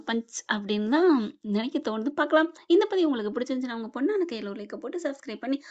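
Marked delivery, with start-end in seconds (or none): none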